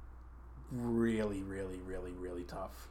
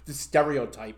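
normal talking voice

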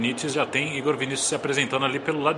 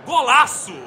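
shouted goal call, long held vowels; explosive goal commentary; 11 semitones higher, 6 dB louder, slower than usual. Acted. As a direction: yell the goal, then savor it. Goal call by play-by-play narrator.

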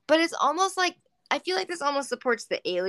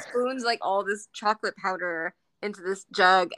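normal talking voice

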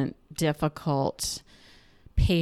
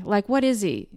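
ordinary talk

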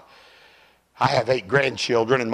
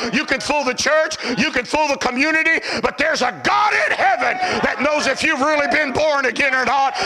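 fiery preaching; 13 semitones higher than usual. Acted. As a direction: build from firm warning to a high shout. Preaching at full force.

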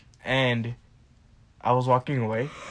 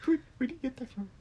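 high, quiet mimicry of the question; mocking echo; 12 semitones higher, 6 dB quieter, faster than usual. Guest parrots the host's question back, mocking high voice.

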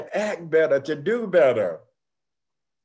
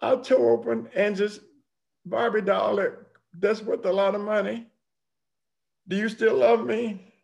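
put-on higher, small voice of a sorry child; little girl confessing; 7 semitones higher than usual. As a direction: ask it like a guilty granddaughter. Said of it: "And she said".

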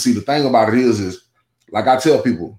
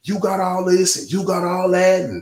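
higher-pitched mock tirade from grandma; imitating an angry grandmother, pitched up; retold cussing out; 9 semitones higher than usual. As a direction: rattle it off like a scolding grandma. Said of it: "she was cussing me out".

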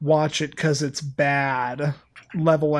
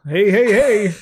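hammed-up Bronx accent; 4 semitones higher than usual. Mocking the Bronx-accented Mario in a bad movie, hammed-up Bronx accent. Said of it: "I'm not gonna milk this accent".

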